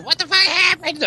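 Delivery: high, shocked yell; mock wife alarm. Wife yelling at scene.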